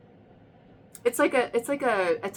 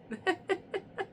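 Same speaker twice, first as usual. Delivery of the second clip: soft high laugh; 11 semitones higher, 7 dB quieter than usual. Real, not acted. Laughs at card surprise.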